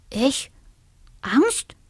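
rising, incredulous; indignant surprise. A narrator's reading. The dormouse denies fear to the dormouse friend, incredulous. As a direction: scoff at the very idea.

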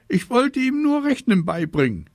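put-on high, loud little-girl protest; high-voiced child justifying herself; defensive sister. A narrator's reading. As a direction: pipe up in self-defence.

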